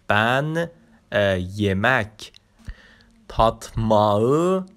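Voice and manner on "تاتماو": تاتماو slowed, rising at the end; slow Turkish word. Shown as a demonstration. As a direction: model تاتماو slowly for the class. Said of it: "تاتماو چوک سویوروم".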